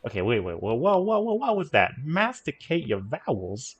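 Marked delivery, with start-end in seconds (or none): 0.6-1.9 higher, louder startled whoa; surprised whoa. Real, not acted. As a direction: caught off guard by the tip. Reacting to odd accent tip.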